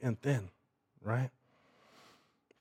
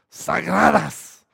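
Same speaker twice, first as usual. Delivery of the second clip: a lightly voiced grandma, drawn-out mock yell of "Sagradas"; old grandma yelling; 2 semitones higher, 3 dB louder, slower than usual. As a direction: imitate a scolding abuela, slow and stretched. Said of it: "An old grandma yelling at him".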